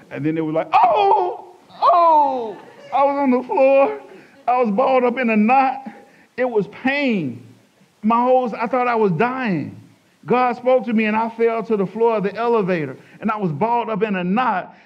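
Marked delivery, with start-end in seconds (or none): 0.7-2.6 loud, high wailed groan; cry of pain. Retold or imitated. Man struck down by pain in the elevator, high agonized wail. 2.9-14.8 high, loud, straining retelling; impassioned testimony. Retold or imitated. Preacher reliving his fall in the elevator, impassioned cry. Reliving being struck down by God.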